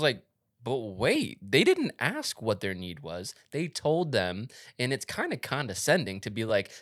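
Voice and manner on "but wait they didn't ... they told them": louder, higher indignant realisation; acted-out objection. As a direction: act out a sudden objection, punchy. Acting out own dawning objection.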